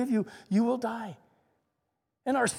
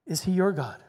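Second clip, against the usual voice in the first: ordinary delivery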